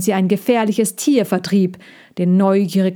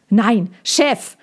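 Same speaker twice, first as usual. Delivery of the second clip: 1 calling loudly. A narrator's reading. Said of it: "rief Henri laut".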